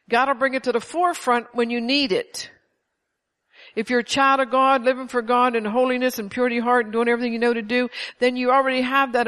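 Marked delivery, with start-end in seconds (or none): none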